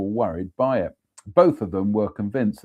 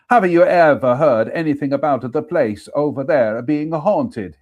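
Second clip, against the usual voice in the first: a second go with a higher, louder mock Italian accent; playful Italian accent, higher and louder; 4 semitones higher, 6 dB louder than usual. Shown as a demonstration. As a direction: redo the question the way the Italian workman would. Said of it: "I'm gonna have to do that".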